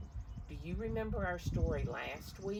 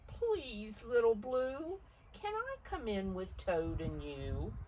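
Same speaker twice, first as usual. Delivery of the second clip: higher, pleading ask; pleading goat; 6 semitones higher than usual. Acted. Goat asking Little Blue to come in, higher and pleading. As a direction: beg sweetly to come in. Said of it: "said Goat".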